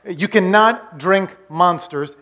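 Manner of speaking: slow, word-by-word warning; deliberate, spaced-out insistence; slow emphasis. Real, not acted. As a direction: spell it out slowly for the congregation. Repeats his warning emphatically.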